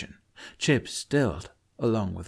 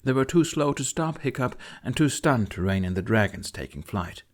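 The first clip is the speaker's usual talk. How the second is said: plain reading voice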